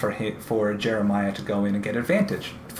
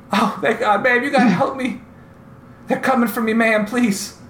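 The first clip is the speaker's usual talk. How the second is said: high, loud pleading of a bleeding man; desperate old man; 12 semitones higher, 4 dB louder than usual. Acted. Bleeding old man begs the heroes for help, high and loud. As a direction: beg for help like you are dying. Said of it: "And he goes".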